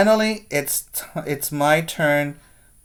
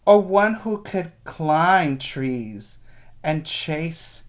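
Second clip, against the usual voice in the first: lively read-aloud storytelling voice; reading to children; 6 dB louder than usual. A narrator's reading. Teacher reading a picture book to the class, animated. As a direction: read it like story time for little kids.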